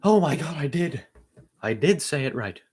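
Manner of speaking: high, delighted astonishment; amazed realisation. Real, not acted. Discovering the take was right, astonished and high. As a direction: burst out high as it dawns on you.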